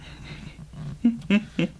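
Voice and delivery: light, high little giggle. Real, not acted. Giggles at the wagging tail.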